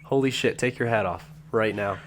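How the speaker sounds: ordinary delivery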